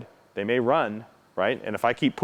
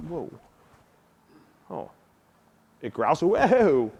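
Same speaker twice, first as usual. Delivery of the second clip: slow, higher, drawn-out soothing whoa; 7 semitones higher, slower than usual. Shown as a demonstration. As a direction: ease off gently, calming a growling dog. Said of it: "I should have gone".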